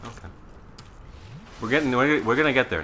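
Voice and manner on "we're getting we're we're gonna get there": excited, psyched up. Real, not acted. Psyching himself up mid-jump.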